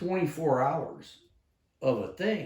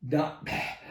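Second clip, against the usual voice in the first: usual speaking voice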